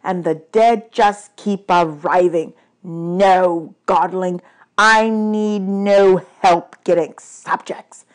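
slow, emphatic god of the dead; weary, angry god. Acted. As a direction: weigh every word with displeasure.

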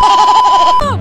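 very high, loud, drawn-out scream; exasperated scream. Acted. Player stuck in the game, long high scream. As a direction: scream it slowly at full height.

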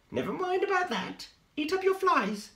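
high, bossy mum; lightly voiced high mum spider. A narrator's reading. Mum spider brushes off Spinderella in a high voice.

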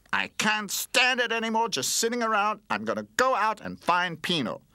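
loud, high-pitched determined outburst; exasperated. A narrator's reading. Toy gives up waiting to go searching, exasperated. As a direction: burst out, high and forceful. Fed-up toy resolves to act.

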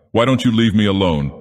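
plain reading voice

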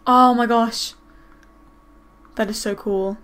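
normal talking voice

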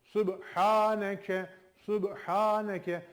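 Subhaneke drawn out slowly, each syllable clear; slow Quranic recitation. Shown as a demonstration. Teacher reciting slowly for students to follow. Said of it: "Okuyalım".

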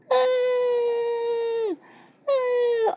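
high sustained mock wail; baby crying. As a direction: keep bawling like a baby. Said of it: "ela continua chorando". (choro fino de bebê)

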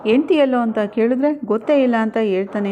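ordinary delivery, nothing marked

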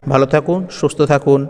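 usual speaking voice

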